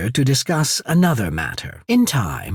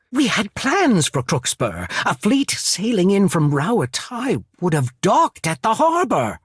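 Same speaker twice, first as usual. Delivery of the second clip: high-pitched, exasperated protest; indignant outburst; 8 semitones higher than usual. Acted. Leader confronting someone over ruined plans, indignant. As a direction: protest hotly as the plans collapse.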